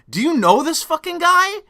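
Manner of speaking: high, stunned disbelief; incredulous question. Retold or imitated. Guard asking the cop about the EMT, high and incredulous. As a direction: ask it like you can't believe this. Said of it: "said it just like".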